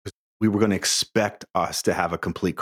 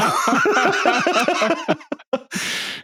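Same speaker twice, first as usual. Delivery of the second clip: high, loud burst of laughter; big laugh; 3 dB louder than usual. Real, not acted. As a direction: laugh hard at yourself. Laughing at his own story.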